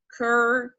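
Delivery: slow isolated "cur-" with a long er vowel; drawn-out syllable. Shown as a demonstration. Teacher isolating "cur-" for students, slow and drawn out. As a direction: stretch out "cur-" to show the er. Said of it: "you say".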